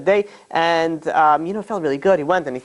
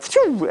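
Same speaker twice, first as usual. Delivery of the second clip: bird swoop noise; 12 semitones higher than usual. Retold or imitated. Birds snatching bread.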